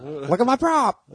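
high toy voice; like a pull-string doll. Retold or imitated. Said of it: "What does he say when you pull the string".

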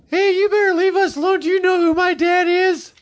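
put-on very high, squeaky pubescent boy; Randy Massey impression. Storyteller as teen friend defending the group, very high squeak. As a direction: confront them in a squeaky grown-up Mickey Mouse voice. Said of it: "He kinda talked like this".

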